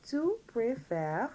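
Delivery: careful, lifted French drill; all together now. Shown as a demonstration. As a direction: lead learners slowly through each word. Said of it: "all together now".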